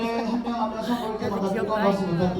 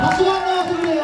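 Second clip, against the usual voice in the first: high, fast shout to the crowd; 11 semitones higher, 5 dB louder, faster than usual. Real, not acted. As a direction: rouse the audience loudly. MC urging the crowd to clap.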